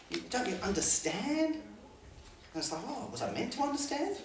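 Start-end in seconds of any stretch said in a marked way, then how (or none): 2.9-4.2 mock innocence. Disciples' sheepish reply voiced.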